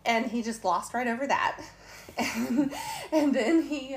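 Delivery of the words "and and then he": laughing through the words; can't help giggling at the memory; real laughter. Real, not acted. Laughing while retelling how the preacher glossed over her back.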